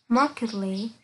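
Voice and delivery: "markedly" with -id- as its own syllable; three-syllable "markedly", MAR-kid-lee. Shown as a demonstration. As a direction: sound out the ED inside "markedly". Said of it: "trạng từ cũng có tận cùng đọc là it".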